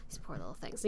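low, soft pitying aside; mock-sorrowful murmur; retold pity. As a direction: drop down low and quiet in sympathy. Retold pity for sick hedgehogs.

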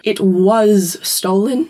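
slow, evasive passive phrasing. Retold or imitated. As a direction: dodge the blame, slowly and vaguely. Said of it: "in the most passive voice ever".

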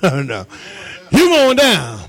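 normal talking voice

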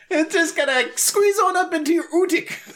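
mock Scottish workman voice, high and laughing; Scottish accent. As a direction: imitate a cheery Scottish tradesman. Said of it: "He sounded Scottish".

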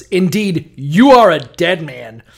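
loud, solemn biblical proclamation, voice pushed up; hammed-up scripture reading of God's threat; booming King James God. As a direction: thunder it like God from on high. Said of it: "But mine goes".

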